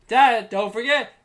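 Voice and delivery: put-on high, loud, slow kid shout; mimicking son calling out. Son reminds dad about breakfast, high loud call. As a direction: yell it after dad from bed.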